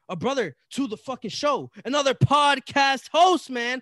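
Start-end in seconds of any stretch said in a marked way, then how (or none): 1.8-3.8 hype shout. Acted. Hyping up the guest.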